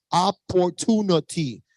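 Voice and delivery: Spanglish accent. Shown as a demonstration. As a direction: stretch "opportunity" out in a Spanglish accent. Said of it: "my little Spanglish accent".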